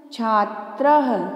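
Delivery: छात्रः slow, with the final h sounded; visarga pronounced. Shown as a demonstration. Teacher modelling the dotted word for children, visarga pronounced. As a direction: stretch छात्रः out and finish on "ha". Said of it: "उच्चारण भी करना है".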